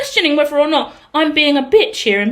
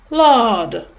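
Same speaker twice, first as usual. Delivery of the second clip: Jamaican lilt; 4 semitones lower than usual. Real, not acted. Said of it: "Bringing out the Jamaican in me".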